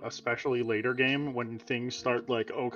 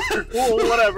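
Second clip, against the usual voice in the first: mom voice; 9 semitones higher, 8 dB louder than usual. Acted. Repeating mom line.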